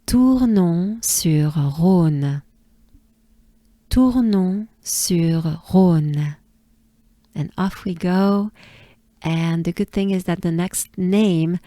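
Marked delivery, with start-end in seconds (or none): 0.1-6.4 Tournon sur Rhône very slowly, each word stretched; drawn-out Tournon sur Rhône with nasal -on and throaty R; slow repeat-after-me French for Tournon sur Rhône; French model pronunciation. Shown as a demonstration. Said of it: "Let's do it together".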